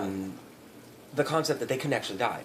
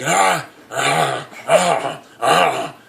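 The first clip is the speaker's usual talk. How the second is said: loud, higher rhythmic grunts; zombie grunts; 4 semitones higher, 10 dB louder, much slower than usual. Retold or imitated. Zombie grunting in rhythm over its victim, loud grunts. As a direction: pulse it like a rutting zombie. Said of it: "the zombie's like".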